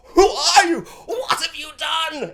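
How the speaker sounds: very high, gasping, horrified cry; mock cowering fear. Cowering character confronting the one above him, mock terrified cry. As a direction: imitate someone cowering in terror, voice shooting up. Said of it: "Zeke's, like, cowering".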